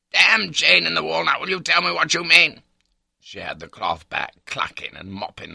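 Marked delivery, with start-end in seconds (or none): 0.1-2.6 high, loud, impatient demand; exasperated outburst. A narrator's reading. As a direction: explode at the wife's dithering. Husband demands an answer.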